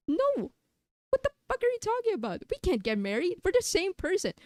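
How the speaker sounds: high fast outraged squeal; outraged shriek. Acted. Streamer's other self shrieks at the idea of marriage. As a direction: screech it in disbelief.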